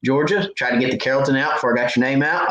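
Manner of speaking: usual speaking voice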